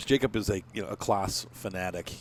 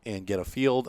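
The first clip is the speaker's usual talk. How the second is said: ordinary delivery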